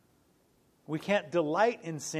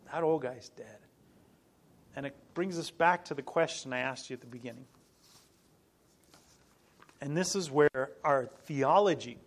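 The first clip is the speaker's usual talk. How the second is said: normal talking voice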